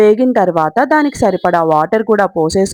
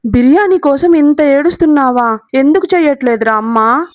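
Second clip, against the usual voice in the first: mock amazement; 6 semitones higher than usual. Amazed child cries over biryani.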